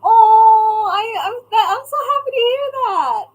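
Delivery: overjoyed, loud and high; gushing delight. Real, not acted. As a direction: squeal with happiness, voice up high. Gushing over chat news.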